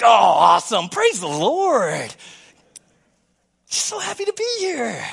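loud, hammed-up happy reply; fake cheerful churchgoer. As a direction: play the instant church smile. Churchgoer faking joy at door.

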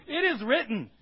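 loud, high, defiant declaring; defiant declaration. Acted. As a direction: shout it back at the devil. Believer shouting back at devil.

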